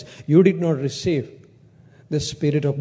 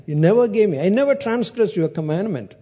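aggrieved protest; 4 semitones higher than usual. Acted. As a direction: voice the elder son's grievance, pushed up high. Elder son's complaint repeated.

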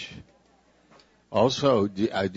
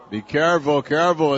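playful warning; 5 semitones higher, 5 dB louder, faster than usual. Real, not acted. Joking warning after tender line.

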